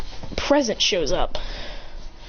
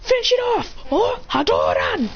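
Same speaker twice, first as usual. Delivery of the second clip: high finishing-move cry; battle command; 11 semitones higher than usual. Acted. Trainer ordering the finishing move, high battle cry.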